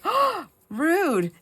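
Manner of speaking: high, loud affronted gasp; hammed-up indignation; mock offended. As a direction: act scandalized at the insult. Acting out her offended comeback.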